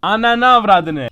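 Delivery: loud, slow furious cursing at the screen; angry outburst. Real, not acted. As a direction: yell the curse out in real rage. Angry gamer outburst at fish.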